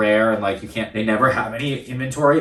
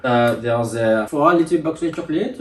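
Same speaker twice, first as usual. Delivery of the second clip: French accent; 2 semitones higher than usual. Shown as a demonstration. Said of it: "do all my French accent stuff".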